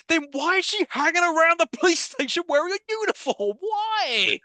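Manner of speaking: high-pitched incredulous rant; exasperated disbelief. Acted. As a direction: demand an answer from a senseless plot, voice way up. Ranting in mock disbelief at the plot.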